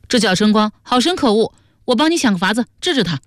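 high, brisk character voice; Yu Baobao, indignant. Acted. Offering to punish a rogue for a companion, indignantly. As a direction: offer help with lively indignation.

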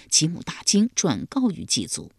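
usual speaking voice

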